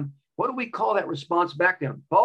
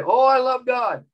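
mock pious; 9 semitones higher, 5 dB louder, slower than usual. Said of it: "We say".

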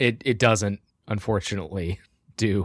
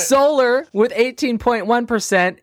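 election announcer; 9 semitones higher than usual. Acted. Reads election results like newscaster.

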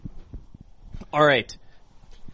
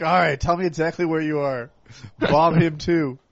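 mock official; 9 semitones higher, 13 dB louder than usual. Mock official trading Piers Morgan.

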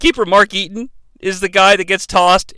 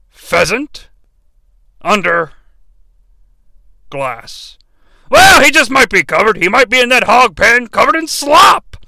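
Vince McMahon impression; 3 semitones higher than usual. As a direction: start dry, then bark it out. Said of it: "Vince responds".

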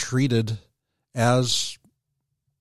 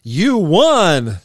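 excited; 5 semitones higher, 7 dB louder than usual. Real, not acted. Quiz host announces win.

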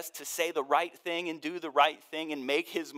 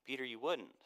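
soft, kind correction; quiet tender Jesus; gentle, kind; 8 dB quieter than usual. Retold or imitated. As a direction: let him down gently. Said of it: "Jesus gently, kindly responds with".

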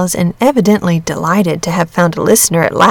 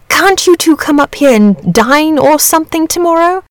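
high, eager invitation; 9 semitones higher than usual. A narrator's reading. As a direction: pitch lifted in hopeful excitement. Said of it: "asked the dragon eagerly".

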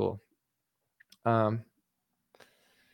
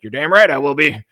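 louder put-on voice, mocking Alex Jones; 5 dB louder than usual. Streamer imitates Alex Jones answering Trump, loud mocking voice. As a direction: bark it like a cocky Alex parody. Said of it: "And Alex is like".

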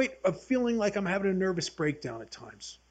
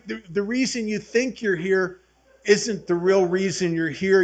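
ordinary talk

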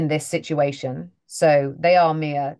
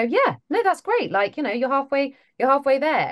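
much higher, bright cheerleading voice for someone's attempt at an accent; mock encouraging; 9 semitones higher than usual. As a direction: hype them up in a high, sing-song encouraging voice. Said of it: "I always wanna just go".

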